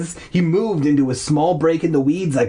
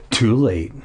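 ordinary delivery, nothing marked

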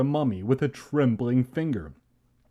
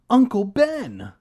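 high, loud, drawn-out warning scream; 9 semitones higher, 8 dB louder than usual. A narrator's reading. Boy warning his uncle of a mummy, scream. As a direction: scream the name in hammed-up fright. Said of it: "I finally managed to scream".

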